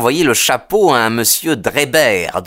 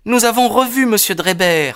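high, confident daughter's voice; clear, assured; 10 semitones higher than usual. A narrator's reading. Daughter confessing before the detective, clear and assured. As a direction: speak up plainly and surely, in a higher voice. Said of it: "d'une voix claire et assurée".

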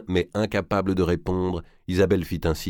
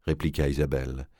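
plain reading voice

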